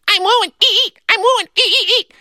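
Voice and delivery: very high, tiny squeal; squeaky mouse. A narrator's reading. Boy turned mouse running through audience, frantic squeaking. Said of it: "started to squeak".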